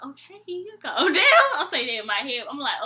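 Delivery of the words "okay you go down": high, loud mock-impressed exclamation; mock amazement. Storyteller voices her silent reaction to the date's rant, mock amazed.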